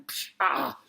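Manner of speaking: thrown-object whoosh, then a yelp; hit-in-the-eye yelp. Acted. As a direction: imitate the impact and cry out. Alien's throw hits captain's eye.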